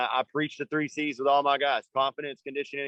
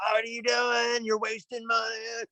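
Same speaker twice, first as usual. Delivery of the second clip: retold dad's yelling; 8 semitones higher than usual. Coach imitating his dad yelling at him, high mock yell. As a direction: imitate an angry dad yelling, pitched way up without real volume. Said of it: "he used to yell at me like".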